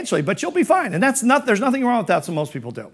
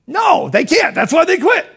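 emphatic shout; 9 semitones higher than usual. Real, not acted. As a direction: slam the answer home with a shout. Answering his own question emphatically.

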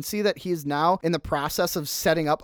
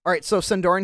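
ordinary delivery

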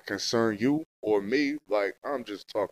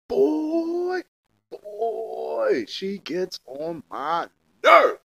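exasperated outburst; 11 semitones higher, 4 dB louder, much slower than usual. Real, not acted. Podcaster venting about his baby mama, exasperated and high. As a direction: let the frustration burst out slowly.

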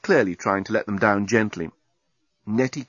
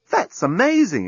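high, impressed outburst; amazed exclamation; 9 semitones higher, slower than usual. A narrator's reading. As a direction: exclaim in astonishment, pitched up. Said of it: "exclaimed Nettie".

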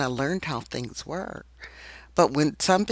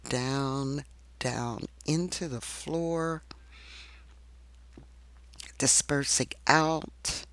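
guided meditation; 5 dB quieter than usual. Acted. Leads a meditation.

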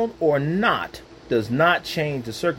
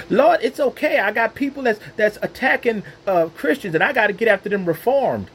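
pleading with God; 7 semitones higher than usual. Pretend. Believer excusing himself to God, higher protest. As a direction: whine your excuse up to God.

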